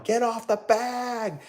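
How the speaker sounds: high, drawn-out mock yell at moderate volume; imitated yelling. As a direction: mimic a heckler's long far-off call. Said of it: "He was yelling".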